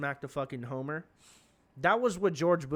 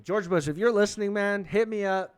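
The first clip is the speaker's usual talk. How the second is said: loud, higher call-out to Bush; 6 semitones higher, 6 dB louder than usual. Real, not acted. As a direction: shout it to someone far off. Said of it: "calling out to George Bush".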